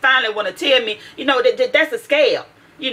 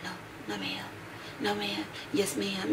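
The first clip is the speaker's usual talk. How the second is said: barely audible whispered mumble; whispering; 16 dB quieter than usual. Retold or imitated. Imitating the daughter answering her parents, whispering. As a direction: imitate a shy, whispered reply, very soft. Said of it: "and she whispering like".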